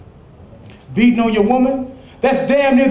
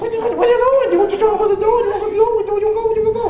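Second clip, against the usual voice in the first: higher, laughing, goading him to fight; high mocking taunt; 12 semitones higher than usual. Man goading his scared friend, high mocking laughter. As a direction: laugh at him and dare him to stay.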